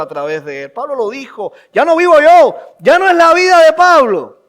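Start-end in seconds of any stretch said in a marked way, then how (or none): 1.7-4.4 loud, high cry from the pulpit; ringing, declamatory scripture quote; impassioned preaching. Acted. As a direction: proclaim the quote at full voice. Preaches, quoting Paul.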